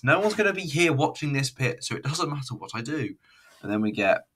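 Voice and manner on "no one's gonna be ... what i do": defiant aside. Real, not acted. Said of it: "I can't do accents".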